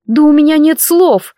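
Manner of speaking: high, loud indignant cry; indignant outburst. A narrator's reading. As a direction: burst out in outrage. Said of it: "возмутилась я".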